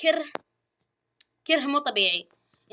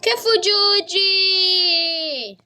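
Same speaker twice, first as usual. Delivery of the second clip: slow high praise; 8 semitones higher, 4 dB louder, much slower than usual. Real, not acted. Praising character.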